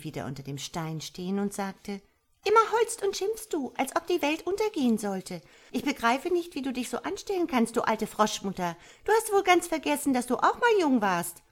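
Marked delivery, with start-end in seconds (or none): 2.4-11.4 high, loud little voice; cheeky tadpole. A narrator's reading. Tadpole talks back to old frog mother, cheeky high voice. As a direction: answer back pertly in a high creature voice.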